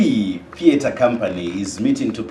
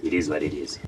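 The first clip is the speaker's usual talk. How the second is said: deep voice; 8 semitones lower, 6 dB quieter, faster than usual. Pretend. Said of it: "You have a very deep voice".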